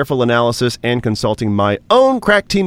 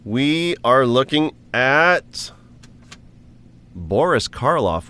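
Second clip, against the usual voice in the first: slow suspense; slower than usual. Acted. Slow suspenseful reveal joke.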